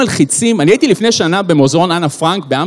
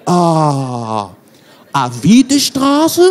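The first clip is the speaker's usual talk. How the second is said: puzzled German driver; 2 semitones lower, much slower than usual. Acted. Taxi driver not understanding the passenger's accent, puzzled. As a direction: play a baffled German cabbie, stretching each word. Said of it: "אז הוא לא מבין".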